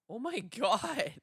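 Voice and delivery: high, loud, drawn-out laughing exclamation; shocked laughter. Real, not acted. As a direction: wail it through real laughter. Reeling from the microphone shock.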